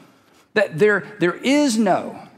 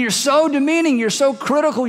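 ordinary talk, nothing marked

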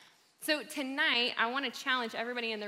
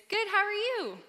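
quick chirpy higher stock answer; automatic reply; 10 semitones higher, 4 dB louder than usual. Retold or imitated. Answering a passing coworker, chirpy and automatic. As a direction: rattle off the reflex answer.

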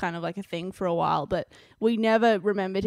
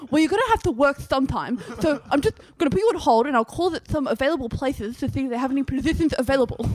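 mock pushy job agent, louder and fast; 6 semitones higher, 5 dB louder, faster than usual. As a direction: rattle it off like a smug caseworker, talking fast. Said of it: "And he was like".

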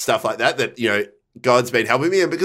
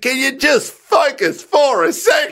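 high, slow, fed-up plea; retold exasperation, pitched way up; exasperated plea; 11 semitones higher, slower than usual. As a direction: beg a distracted friend, word by word. Pleading with distracted friend.

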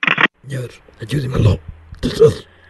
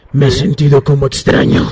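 possessed voice; 9 semitones higher, faster than usual. Acted. El poseído interrumpe.

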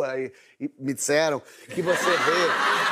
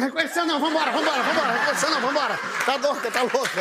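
mock panicked urging; 6 semitones higher, faster than usual. Foge de quem o reconheceu.

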